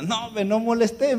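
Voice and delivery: loud, high, drawn-out protest; exasperated refusal. Retold or imitated. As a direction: act out a cousin waving off a pest. Cousin refusing to help, acted out.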